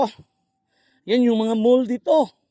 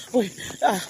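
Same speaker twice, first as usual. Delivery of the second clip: normal talking voice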